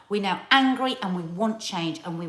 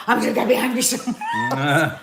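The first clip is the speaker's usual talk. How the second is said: a half-laughing louder outburst; laughing retort; 7 dB louder than usual. Retold or imitated. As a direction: shout it through a laugh. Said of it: "I went".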